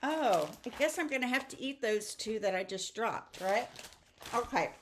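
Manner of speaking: ordinary talk, nothing marked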